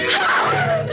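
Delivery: high piercing scream of a name. Acted. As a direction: shriek his name in horror. Finds husband dead.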